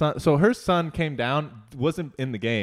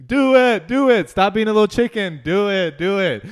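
high, loud goading chant; pushy, hammed-up peer pressure; mock egging-on; 9 semitones higher, 9 dB louder than usual. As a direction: shout it like a crowd daring someone. Aunts egging him on.

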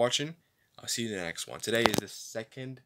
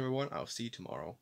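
ordinary talk, nothing marked

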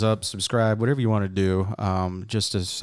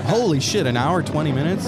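surprised; 6 semitones higher, 3 dB louder than usual. Real, not acted. Realizing episode ran long.

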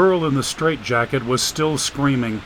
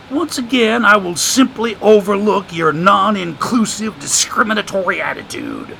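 put-on higher, sly voice of an old drifter; streetwise homeless man; 6 semitones higher, 4 dB louder than usual. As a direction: play the wisecracking drifter, amused. Joshua's voice in the story.

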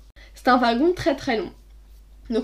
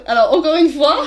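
giggling through the words, loud and higher; can't hold back laughter; laughing; 5 semitones higher, 8 dB louder than usual. Retold or imitated. Retelling her answer to the suitor, laughing through it. Laughing while quoting herself.

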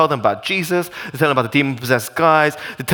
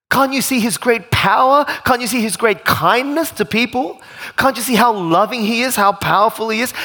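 higher, pleading exasperation, short of screaming; exasperated outburst; 5 semitones higher than usual. Acted. Preacher wanting to scream at townspeople, exasperated appeal. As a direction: remonstrate urgently, pitch raised throughout. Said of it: "don't you just want to scream at these people".

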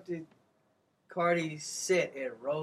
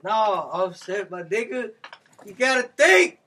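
scolding; 3 semitones higher, 9 dB louder than usual. Real, not acted. Scolding [name].

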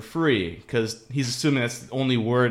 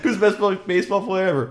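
high-pitched, louder replay through laughter; laughing retelling; 8 semitones higher, 4 dB louder than usual. Acted. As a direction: retell the punchline setup, cracking up. Replaying the joke amid laughter.

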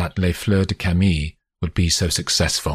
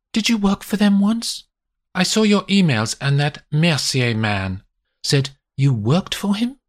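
higher, sharp accusing wife's voice; 11 semitones higher than usual. A narrator's reading. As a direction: voice a betrayed mother, pitched up and pointed. Wife voiced.

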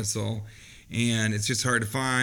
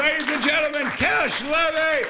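announcer shout; 13 semitones higher, faster than usual. Acted. Announcing guest to applause.

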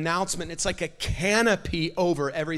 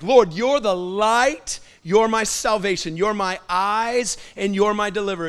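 fervent declaration; 3 semitones higher, 9 dB louder than usual. Shown as a demonstration. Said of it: "work it up in your heart".

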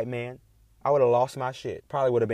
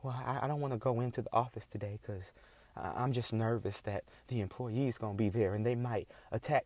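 mock timid boss; 9 dB quieter than usual. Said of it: "How does that sound?".

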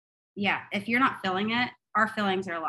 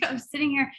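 ordinary talk

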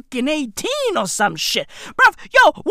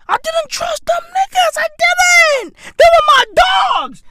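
high, loud outburst; 14 semitones higher, 4 dB louder than usual. Real, not acted.

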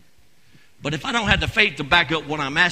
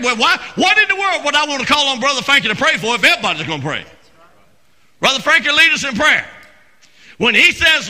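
loud, raised pulpit voice; animated revival preaching, pitched up; fervent preaching; 7 semitones higher, 6 dB louder than usual. Real, not acted. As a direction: drive the point home to the congregation. Preaches with rising force.

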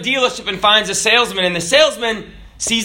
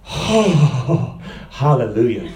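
lower, laughing "hallelujah"; relieved laugh; 9 semitones lower, slower than usual. Acted. Priest thanks God, laughing relief.

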